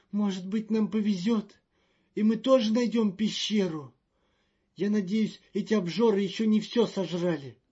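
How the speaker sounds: high, loud and fretful; a jittery official in a panic; nervous diplomat voice. Acted. Magnan grumbles hopefully.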